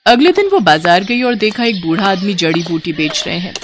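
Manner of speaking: ordinary delivery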